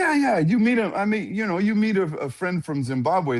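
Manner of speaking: retold breezy reassurance. Friend's breezy retold explanation.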